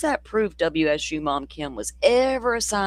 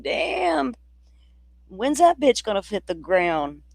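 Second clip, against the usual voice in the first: amazed; 5 semitones higher, slower than usual. Retold or imitated. Reacting to falling pine.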